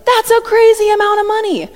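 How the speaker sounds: high, loud amazed outburst; stunned Peter. Retold or imitated. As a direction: gasp it out like the sum just floored you. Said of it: "Peter's eyes would have been like".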